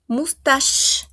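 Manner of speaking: "Moustache" ending in a long shhh; French "Moustache", CH softened to sh. Shown as a demonstration. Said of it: "A una sh, al sh".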